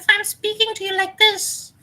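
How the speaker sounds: very high squeaky demo voice; high-pitched demonstration. Shown as a demonstration. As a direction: speak way up high in a shrill voice. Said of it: "is it a high pitch?".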